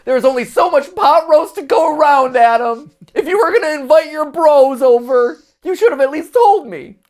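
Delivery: high, loud nagging wife voice; scolding wife. Pretend. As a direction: scold him like an exasperated wife. Voicing angry wife scolding husband.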